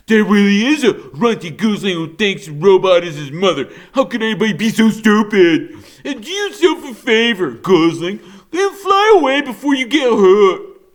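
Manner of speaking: high, fast, loud taunting with laughs; laughing, mocking bear. A narrator's reading. Nettle jeering at Bright Bill, laughing and high. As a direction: laugh at the little goose as you jeer. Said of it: "Nettle laughed".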